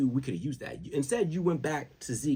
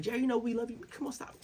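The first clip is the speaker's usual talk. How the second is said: mock pleading; 12 semitones higher, faster than usual. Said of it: "They're like".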